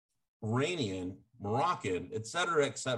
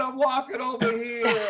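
higher, louder Brooklyn shout; 8 semitones higher, 5 dB louder than usual. Pretend. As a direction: yell it like a New York cabbie. Shouted parody of movie line.

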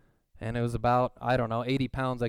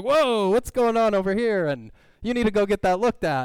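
high, loud, hyped-up exclamation; hyper coach; 8 semitones higher, 7 dB louder than usual. Retold or imitated. Coach spotting the bleeding toe, hyper and loud. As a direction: play the hyper coach bursting over. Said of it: "like, hyper guy".

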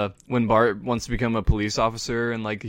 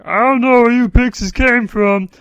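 Ralph Wiggum voice; 13 semitones higher, 13 dB louder than usual. Retold or imitated.

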